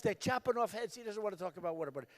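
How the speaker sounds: soft, fast inner thought; muttering to himself. Retold or imitated. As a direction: mutter it under the breath, falling quiet. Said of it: "I'm saying to myself".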